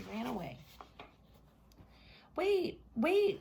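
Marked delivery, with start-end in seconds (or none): 2.4-3.4 high loud frog call; frog crying out. Acted. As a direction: shout after her as the frog. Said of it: "cried the frog".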